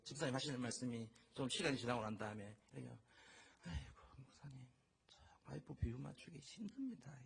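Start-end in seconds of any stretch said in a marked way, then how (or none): 3.6-7.1 hushed, sighing retold complaint; imitated weary grumble. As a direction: confide a husband's woe to the pastor, softly. Retells husband grumbling to pastor.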